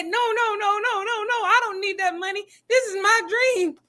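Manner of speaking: high, whiny imitation of starry-eyed girls; mock pleading. Mocks the girls' refusal of money.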